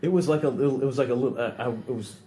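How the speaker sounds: halting, stuck on words; stammering. Real, not acted. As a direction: trip over the words. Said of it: "I'm fucking stammering here".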